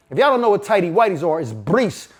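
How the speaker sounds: normal talking voice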